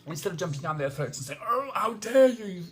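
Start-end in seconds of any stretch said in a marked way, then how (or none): 1.5-2.6 high, loud, indignant gasp; hammed-up high-pitched offence; mock outrage. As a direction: send up the offended person. Mocking an outraged reaction.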